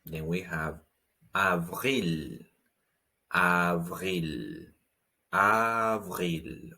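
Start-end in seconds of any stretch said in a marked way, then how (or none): 1.3-6.7 avril with a throaty French R, ah-vreel; avril, R from the back of the throat; avril, uvular R. Shown as a demonstration. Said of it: "Also with a French R".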